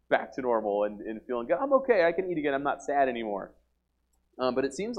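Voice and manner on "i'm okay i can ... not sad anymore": mock instant cheer. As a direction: chirp it like everything is suddenly fine. Mocks instant cure after blessing.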